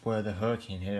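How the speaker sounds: ordinary delivery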